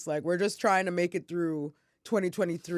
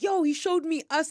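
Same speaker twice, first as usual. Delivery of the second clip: high-pitched retold alarm; freaking out; 8 semitones higher than usual. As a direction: imitate someone freaking out on the phone. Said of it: "he's, like, freaking out".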